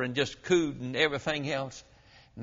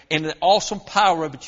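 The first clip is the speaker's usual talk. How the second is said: ordinary talk, nothing marked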